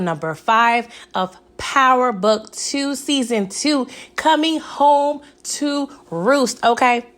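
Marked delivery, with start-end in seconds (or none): none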